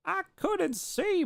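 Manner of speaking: very high, slow, rising anime whine. Real, not acted. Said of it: "You sound like an anime person".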